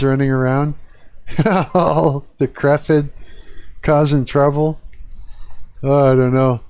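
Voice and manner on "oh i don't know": usual speaking voice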